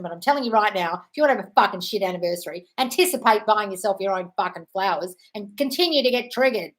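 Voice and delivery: ordinary talk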